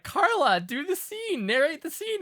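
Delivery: high, pleading quote voice; high mock plea. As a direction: beg her in a high voice. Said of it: "we were like".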